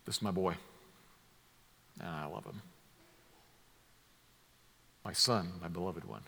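put-on a soft, low, tender "my boy" and "my beloved one"; fond endearment. Shown as a demonstration. Preacher showing the wrong, sentimental reading, soft and tender. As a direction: say it like a dad cooing over his boy. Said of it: "No, that's not it".

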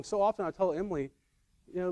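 ordinary talk

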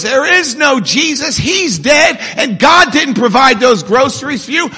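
high, loud mock gloating; hammed-up atheist crowing, building to a shout; acted triumphant yelling. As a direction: punch "I" as the gotcha. Atheist gloating at praying woman.

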